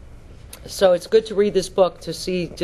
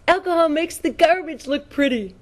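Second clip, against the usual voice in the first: pitched-up giddy line about pretty garbage; higher, dreamy mock of the drunk wife; mock tipsy woman; 9 semitones higher than usual. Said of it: "And she goes".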